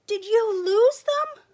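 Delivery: high, loud, slow accusing question; indignant cry. Acted. Sheep accusing Sheepdog of losing the sheep, indignant cry. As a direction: blurt out the accusation.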